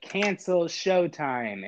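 very slow, overly clear; "Cancel Showtime" stretched slowly, spoken to a machine; slow deliberate command. Retold or imitated. Repeated command, slowed.

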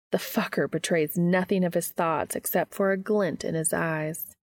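ordinary delivery, nothing marked

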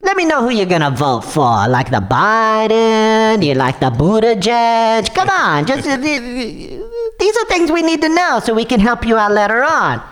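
put-on light Russian accent, pushy scammer; smooth-talking caller with a Russian accent. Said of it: "his Russian accent's coming out".

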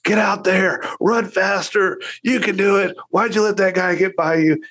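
pushy sideline dad. Retold or imitated. Dad pushing his son from the sideline, mock sideline coaching. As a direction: bark encouragement like an overbearing dad. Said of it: "And I'm like".

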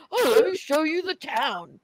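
high, slow, eager stranger voice; hammed-up friendly guy showing off the town; mock eager host. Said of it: "He was like".

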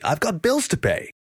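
high, happy character voice; cheerful, high-pitched teammate. A narrator's reading. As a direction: pipe up happily, well above the narration. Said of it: "Richard said happily".

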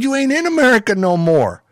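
high, emphatic Southern lawman impression; Southern sheriff. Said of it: "the typical old Southern sheriff".

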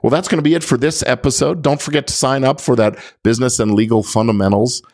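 normal talking voice

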